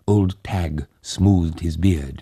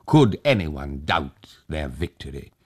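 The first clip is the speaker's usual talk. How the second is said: ordinary delivery, nothing marked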